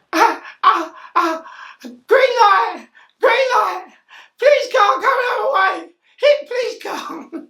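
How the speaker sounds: high, loud, wailing plea, laughing through it; mock injured pleading. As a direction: whimper and beg like you're hurt on the road. Injured man pleading with police.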